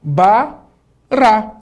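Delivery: Ba, Rá, each syllable loud and separated; teacher building the word bará; syllable sounding. Shown as a demonstration. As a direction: spell out Ba then Rá. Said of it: "R com A faz Rá".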